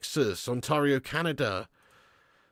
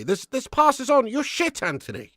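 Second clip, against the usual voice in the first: exasperated yell; 10 semitones higher than usual. Real, not acted. Fan berates winger.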